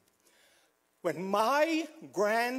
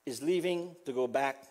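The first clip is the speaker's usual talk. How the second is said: ordinary talk, nothing marked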